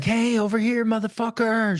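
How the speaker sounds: high mock yell, no real volume; retold taunting holler, high-pitched; imitated yelling. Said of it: "She's yelling from the bushes".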